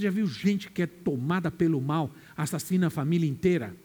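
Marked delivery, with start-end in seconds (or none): none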